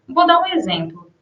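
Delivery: normal talking voice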